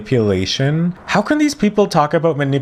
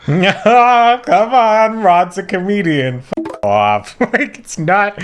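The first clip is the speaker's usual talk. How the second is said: high laughing disbelief, louder and breaking into laughs; 10 semitones higher, 4 dB louder, slower than usual. Real, not acted. Mocking a clip guest, laughing through it. Laughing at clip's comedian claim.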